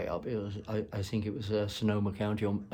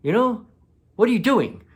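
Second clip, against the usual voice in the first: higher and louder, an indignant rant; exasperated scolding; 10 semitones higher, 6 dB louder than usual. Retold or imitated. Scolding the ex for letting the ranger turn on her, exasperated. Scolding continues after a listener's interjection.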